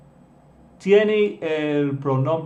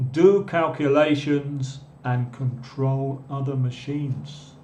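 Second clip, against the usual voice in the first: slow, careful dictation; slower than usual. Shown as a demonstration. Teacher reads a definition aloud to the class, slow and careful. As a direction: read it slowly, pausing between phrases. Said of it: "listen to this one carefully".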